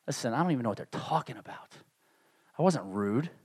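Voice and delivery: low, very soft retold self-defence; muted protest. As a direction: protest innocence under your breath. Retold protest to his pastor.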